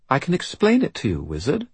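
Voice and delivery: put-on high, formal little-girl voice; composed British child, pitched high; self-possessed child archive. A narrator's reading. Child offers the wizard an explanation, composed and serious.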